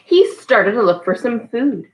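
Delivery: slow, drawn-out storybook reading; patient read-aloud for a toddler; reading to a child. Acted. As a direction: leave pauses for the child to fill in. Reads The Very Hungry Caterpillar.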